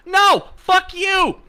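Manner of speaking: screaming high and loud; furious shout. Acted. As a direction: yell it back in outrage. Angry retort to YouTube's request.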